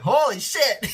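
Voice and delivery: a loud, high burst of shock; shocked exclamation. Retold or imitated. Watching a horrifying man spider scene, shocked outcry. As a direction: blurt it out like you can't believe your eyes. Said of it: "I was like".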